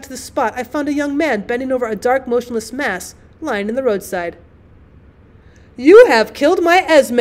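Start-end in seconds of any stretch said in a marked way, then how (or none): 5.8-7.2 higher, louder and slow, bitterly accusing; bitter exclamation. Acted. The Baroness accuses the motorist over her dog, bitter and loud. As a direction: hurl the blame at him, bitter and loud. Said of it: "I exclaimed bitterly".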